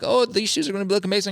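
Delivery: high, excited stylist gush; hyped stylist. Retold or imitated. Stylist hyping up a nervous model, high excited voice. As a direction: hype up the shoes.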